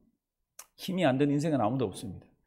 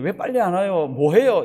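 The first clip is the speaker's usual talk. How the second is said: mock-wail a baby's demand; louder baby cry; 3 semitones higher, 8 dB louder than usual. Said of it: "조금 더 큰 소리 울 때는".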